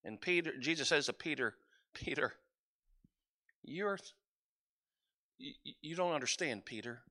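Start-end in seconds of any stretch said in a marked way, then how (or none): 1.9-7.0 gentle rebuke. Acted. Jesus answering Peter gently.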